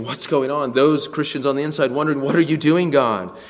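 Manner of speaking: loud, rising cry of bewilderment; urgent questioning. Acted. Bewildered Christians questioning God, urgent and loud. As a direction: demand an answer, acting it out.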